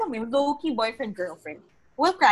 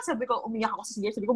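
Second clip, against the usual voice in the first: ordinary talk, nothing marked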